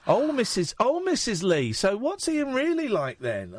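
high-pitched mock gushing, drawn out at the end; gushing nosy questioner. Host mimicking someone quizzing his mum about him, mock gushing. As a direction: imitate an eager busybody, pitched right up.

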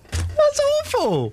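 very high, slow, laughing squeal; squealing amusement. Real, not acted. Host judging colleague's Geordie attempt, high squealing laughter. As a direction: squeal it out through your laughter.

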